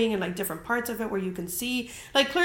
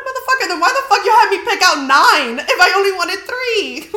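loud, high, laughing outrage; outraged yell; 9 semitones higher, 12 dB louder than usual. Retold or imitated. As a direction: yell it out in mock fury through a laugh. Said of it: "I'm like".

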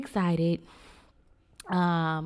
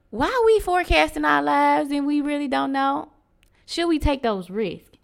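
higher and louder appeal; impassioned; 9 semitones higher, 6 dB louder than usual. Real, not acted. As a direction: press the question on them. Rhetorical appeal to listeners.

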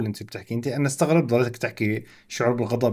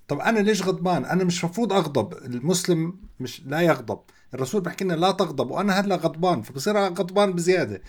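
higher-pitched, agitated inner monologue; self-reproach; 6 semitones higher than usual. Acted. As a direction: act out someone scolding themselves for being angry.

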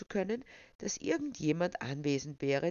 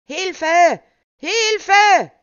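long, high full-force cry for help; shouting for help; 18 dB louder than usual. A narrator's reading. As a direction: yell as loud as you can. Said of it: "schrie er so laut er konnte".

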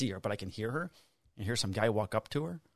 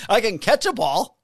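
slow, louder, higher protest; drawn-out I can catch a ball; insisting to the manager that he can catch; emphatic insistence; 8 semitones higher, 9 dB louder than usual. Retold or imitated.

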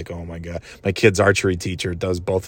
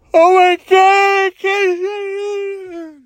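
laughing fit; 5 dB louder, much slower than usual. Real, not acted. Laughing fit at own joke.

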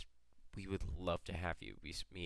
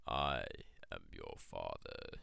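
movie villain voice; 11 semitones lower, much slower than usual. Retold or imitated. Famous movie villain line.

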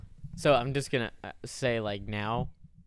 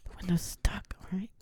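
tense whisper; 7 semitones higher, 4 dB quieter than usual. Acted. Sniper whispers back in roleplay.

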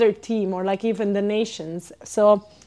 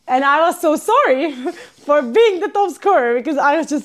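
laughing; 5 semitones higher, 4 dB louder than usual. Real, not acted. Laughing while retelling.

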